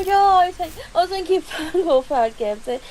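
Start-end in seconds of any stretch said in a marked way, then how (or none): 2.7-2.8 crying out loud in despair, pitch breaking; sobbing cry. Acted. Zilin, alone in the rain, sobbing over the lost hairclip. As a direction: wail it through tears, begging to know why.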